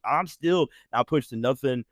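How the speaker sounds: normal talking voice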